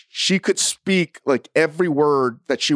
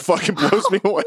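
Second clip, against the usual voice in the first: words breaking into laughter; laughing amazement. Real, not acted. Marveling at their Australian speech, breaking into laughter. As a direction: say it as the laugh takes over. Cracking up at own remark.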